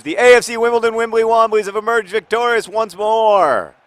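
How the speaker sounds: high, loud, slow victory chant; triumphant proclamation. Real, not acted. Host celebrating his team's victory, loud slow triumphant chant. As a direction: crow over the win like a herald. Team wins the game.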